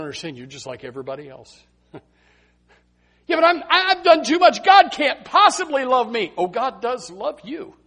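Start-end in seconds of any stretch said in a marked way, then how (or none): 3.3-6.3 higher, pleading voice; despairing sinner. Retold or imitated. Preacher voicing a despairing person who feels unsaveable, higher. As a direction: protest that you're too far gone, higher. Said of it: "that have said".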